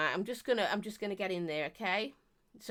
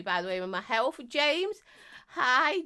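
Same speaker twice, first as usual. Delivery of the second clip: ordinary talk, nothing marked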